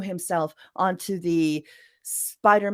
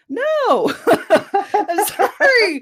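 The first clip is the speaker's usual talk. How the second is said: laughing no; 12 semitones higher, 9 dB louder, much slower than usual. Real, not acted. Laughing rejection of bad advice.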